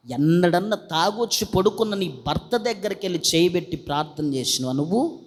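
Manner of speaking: ordinary talk, nothing marked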